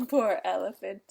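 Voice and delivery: ordinary delivery, nothing marked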